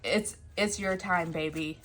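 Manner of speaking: ordinary talk, nothing marked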